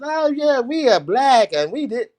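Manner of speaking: high, mocking singsong parody; mock them in a high, silly voice; mocking quote. Host mimicking online critics with high mocking voice. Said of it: "stop telling me about all these little word soup salads".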